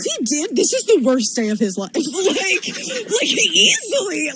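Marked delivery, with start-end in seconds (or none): 1.9-4.3 laughing. Real, not acted. Laughing at the story.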